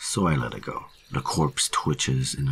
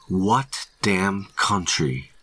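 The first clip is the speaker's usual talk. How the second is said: slow, higher, emphatic question; stern demand; 5 semitones higher, much slower than usual. A narrator's reading. Ranger pressing hiker for an answer, slow angry demand. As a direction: lean hard on each word.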